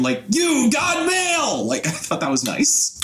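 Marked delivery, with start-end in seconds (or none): 0.3-1.6 high, drawn-out shouted catchphrase; high stretched announcing yell; movie line impression. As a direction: belt it out like the film's line, long and high. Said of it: "I really like the line".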